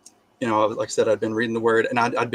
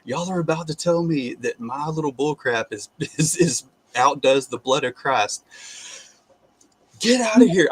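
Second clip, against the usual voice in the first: mocking, higher voice, laughing them off; scornful laughing retort; 4 semitones higher than usual. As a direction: scoff at the demons. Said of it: "I just left these things to scorn".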